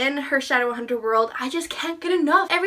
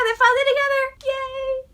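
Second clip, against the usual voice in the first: excited cheer; 10 semitones higher than usual. Real, not acted. Cheering Ron and Hermione together.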